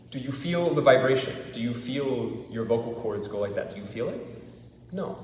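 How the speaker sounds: ordinary talk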